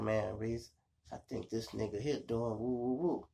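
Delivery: low murmured warning to his friend; hushed tip-off. Retold or imitated. Brother tipping him off quietly, hushed. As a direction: keep it down, sharing a secret.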